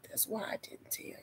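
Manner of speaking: whispered, quick reply as the doctor; hushed doctor. Retold or imitated. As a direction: drop to a barely audible whisper.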